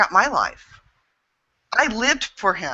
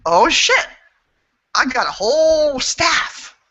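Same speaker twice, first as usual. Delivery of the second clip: surprised realization; 8 semitones higher, 3 dB louder, slower than usual. Retold or imitated. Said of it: "And then I realized".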